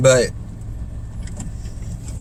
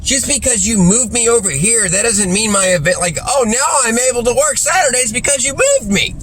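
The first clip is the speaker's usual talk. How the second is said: higher-pitched mock-indignant protest; mildly exasperated, raised-pitch comeback; indignant retort; 6 semitones higher than usual. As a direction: argue back, pitch climbing. Acted argument with supervisor.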